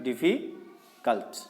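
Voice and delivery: Difficult drawn out slowly, pausing between syllables. Shown as a demonstration. Teacher showing students difficult can't be read at once, slow syllables. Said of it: "इसको एक बार में नहीं पढ़ सकते".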